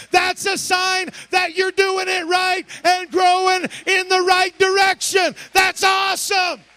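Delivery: loud, ringing pulpit cry; fiery camp-meeting sermon; full-force preaching. Acted. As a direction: preach it to the congregation, building.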